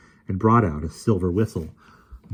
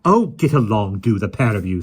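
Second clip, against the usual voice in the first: snappy; 3 semitones higher, 7 dB louder than usual. A narrator's reading. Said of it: "said Mary Poppins snappily".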